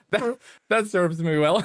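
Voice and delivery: helpless high giggle through slow words; real laughing. Real, not acted. Streamer crashed showing off his driving, laughing hard.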